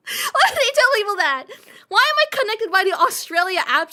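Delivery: high, loud, fast mock outrage; outraged squeal at a silly feature. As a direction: squeal it in fake horror.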